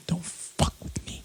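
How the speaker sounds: breathy mocking whispered echo of the threat. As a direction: hiss it back like a mock threat. Repeats line in a whisper.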